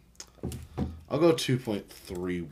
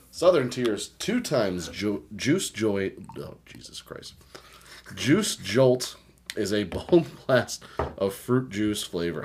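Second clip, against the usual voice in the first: hammed-up label reading, slow; 3 semitones higher, 5 dB louder, slower than usual. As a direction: read the can like a commercial. Reads the can's ad copy.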